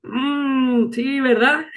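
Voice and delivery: high, fake-agreeable hum; mock polite agreement. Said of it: "era como que". (fingiendo estar de acuerdo)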